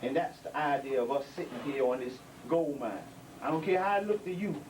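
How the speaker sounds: ordinary delivery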